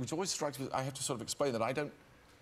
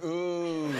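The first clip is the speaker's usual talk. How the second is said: long, raised crowd "ooh"; imitated German booing; 5 semitones higher, 4 dB louder than usual. Actor imitating Germans booing him at the train, mock jeer. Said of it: "hear was people going".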